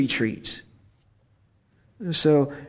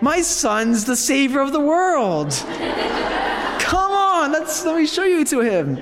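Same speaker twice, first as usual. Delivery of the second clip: much higher, beaming proud mother's voice; proud Mother Mary; 9 semitones higher than usual. Acted. Priest voicing Mary as she proudly introduces Jesus, high and beaming. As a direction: show off her son like a delighted mother. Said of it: "So Mary's like".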